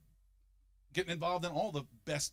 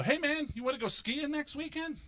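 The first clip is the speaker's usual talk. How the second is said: eager friend; 11 semitones higher than usual. Retold or imitated. Friends inviting the fifty-year-old skiing, high and eager. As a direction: pitch it up like a keen pal. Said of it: "a group of friends will come to me and go".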